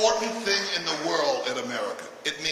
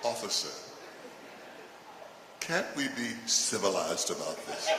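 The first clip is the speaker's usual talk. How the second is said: whitest voice; 7 semitones lower, 7 dB quieter than usual. Pretend. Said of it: "in your whitest voice".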